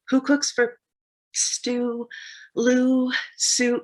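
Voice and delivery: high, hooted owl voice; high-pitched owl composing aloud; owl call. Acted. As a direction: hoot each rhyme out like an owl, with pauses. Owl poet trying out rhymes.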